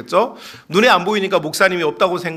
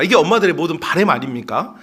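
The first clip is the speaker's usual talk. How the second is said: ordinary talk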